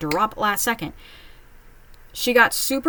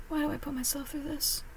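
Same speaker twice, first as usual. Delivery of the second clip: much softer, higher sigh of a question; weary aside; 6 semitones higher, 13 dB quieter than usual. Real, not acted. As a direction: mutter it to yourself, worn out. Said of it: "self-pitying aside".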